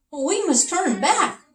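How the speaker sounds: high, alarmed king; king's voice. Acted. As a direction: cry out like a scared little king. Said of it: "said the king".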